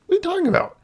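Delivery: very high-pitched incredulous question; squeaky disbelief. Retold or imitated. Kid disputing a friend's claim, squeaky disbelief. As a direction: ask it in a strained high squeak.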